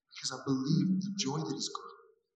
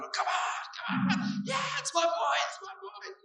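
mock sideline cheer, much higher; cheering parent; slower than usual. Preacher imitating a sports parent's cheer, much higher. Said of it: "the parent's running, going".

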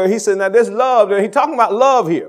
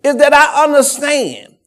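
full-force preaching; 3 semitones higher, 6 dB louder than usual. Acted. Pastor assuring the church God provides, full-force preaching. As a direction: preach it hard, calling out the church.

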